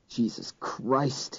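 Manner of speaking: low slow mutter. A narrator's reading. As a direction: mutter it under your breath. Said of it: "he muttered".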